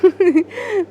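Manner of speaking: a high mocking chuckle; scornful laugh. A narrator's reading. Husband laughs scornfully at the wife's accusation. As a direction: laugh him off with contempt. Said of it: "Govind പുച്ഛത്തോടെ ചിരിക്കും".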